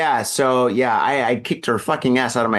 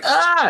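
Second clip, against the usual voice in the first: pained yelp. Retold or imitated. Shock of electric jolt retold.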